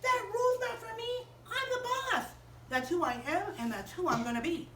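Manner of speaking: stomp and insist like a brat; stubborn bossy kid. Acted. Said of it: "stomped her feet and she said".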